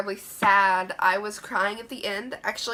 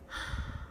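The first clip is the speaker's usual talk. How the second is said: soft gasp; 11 dB quieter than usual. Pretend. Mock realization gasp.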